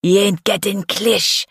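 put-on defiant Jamaican-accented stepdad; angry Jamaican accent. A narrator's reading. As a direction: argue back in a Jamaican accent. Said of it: "his Jamaican accent thickening with anger".

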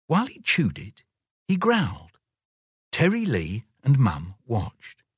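plain reading voice

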